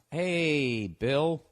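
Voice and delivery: drawn-out, stalling greeting; deliberately slow reading. Shown as a demonstration. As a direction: stretch each word to fill time. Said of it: "I'm gonna read slow".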